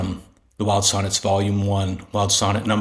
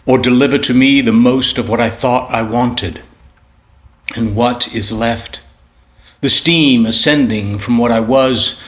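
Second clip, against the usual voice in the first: opening lines declaimed louder, then easing back; poem reading; 3 semitones higher, 5 dB louder than usual. Acted. Poet reading his sonnet to listeners, declaimed with force. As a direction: recite with rising force, then let it settle.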